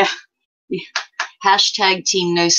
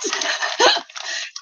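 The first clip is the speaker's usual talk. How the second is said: breathy laugh; 4 dB quieter than usual. Real, not acted. Laughing at mumbling impression.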